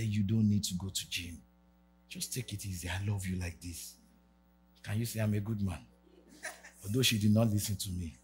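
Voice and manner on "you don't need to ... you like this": tender reassurance. Retold or imitated.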